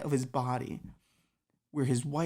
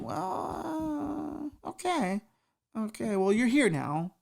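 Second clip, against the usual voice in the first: awkward Satan; 9 semitones higher, much slower than usual. Acted.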